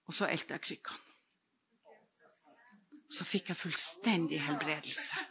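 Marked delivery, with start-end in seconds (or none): none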